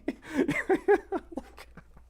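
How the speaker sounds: squealing high laugh. Real, not acted. Streamer cracking up at chat, high squealing laugh.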